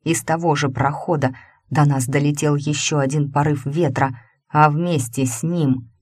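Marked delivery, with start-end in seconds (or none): none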